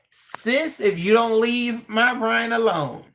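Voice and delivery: high, slow, drawn-out put-on threat; mock diva warning. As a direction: play it as a sassy sister warning someone off. Warning a chatter off Brian.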